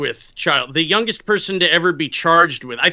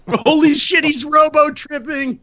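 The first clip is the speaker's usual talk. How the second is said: excited; 8 semitones higher than usual. Real, not acted. Laughing excited reaction.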